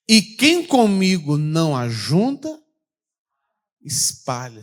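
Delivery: loud, slow verse; proclaimed verse. Acted. Pastor reads Matthew to congregation, slow and loud. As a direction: stress the gathering.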